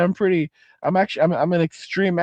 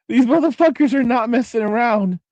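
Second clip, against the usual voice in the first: higher-pitched, through a laugh; laughing emphasis; 5 semitones higher, 3 dB louder than usual. Real, not acted. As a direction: burst it out while laughing. Laughs, then repeats the warning.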